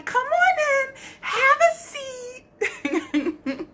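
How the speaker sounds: high singsong hostess voice; playful high-pitched welcome. Acted. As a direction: sing it out like greeting guests.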